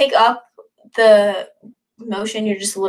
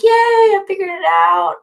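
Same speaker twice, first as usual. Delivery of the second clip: much higher, slower gleeful yay; excited cheer; 10 semitones higher, 5 dB louder than usual. Pretend. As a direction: squeal with pride at finally getting it. Proud dancer finally gets it.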